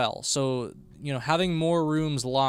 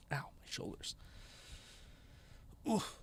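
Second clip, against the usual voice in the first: pained whisper; 6 semitones higher, 15 dB quieter than usual. Real, not acted. Groaning about sore shoulders.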